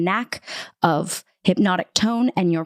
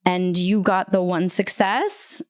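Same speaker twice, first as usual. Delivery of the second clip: ordinary talk, nothing marked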